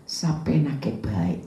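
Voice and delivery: slow, soft Tegal Javanese phrase; a quick Tegal Javanese aside; Tegal Javanese accent. Retold or imitated. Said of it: "Orang Tegal bilang".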